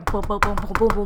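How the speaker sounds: rapid-fire "boom" for pounding footsteps; imitated running footsteps. Storyteller recounting being chased, imitated footsteps. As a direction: voice the thudding steps of a chaser.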